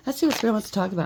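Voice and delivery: fast, out-of-breath delivery. Real, not acted. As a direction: speak while catching your breath. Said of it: "I'm a little bit out of breath".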